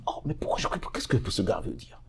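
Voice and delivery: breathy, unvoiced puzzled aside; whispered confusion. Rookie host puzzled, whispering to himself. Bewildered inner reaction.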